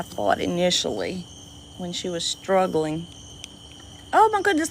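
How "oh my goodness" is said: surprised. Real, not acted. Surprised the turtle just spit.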